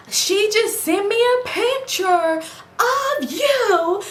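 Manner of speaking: high, slow, drawn-out mock voice of herself; exaggerated quoting voice. Retelling how she confronted her boyfriend, in a high drawn-out put-on voice. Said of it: "let me talk regular".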